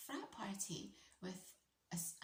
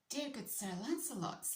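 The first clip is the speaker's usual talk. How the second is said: higher, hammed-up posh address to a knight; mock posh knight greeting; 6 semitones higher, 3 dB louder than usual. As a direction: put on a grand old-fashioned English courtesy. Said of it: "I didn't say that".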